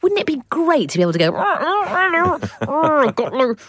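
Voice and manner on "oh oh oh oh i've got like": ventriloquist voice. Pretend. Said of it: "That was appalling".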